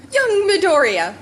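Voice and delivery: hammed-up booming greeting; booming hero call. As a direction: boom his name out like a hero. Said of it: "All Might's voice boomed".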